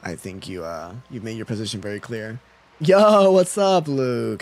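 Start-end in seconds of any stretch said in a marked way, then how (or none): 2.8-4.4 high, booming hello; loud greeting. Real, not acted. Streamer hails Luke as he arrives in chat, loud greeting. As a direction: call it out loudly, pitched up.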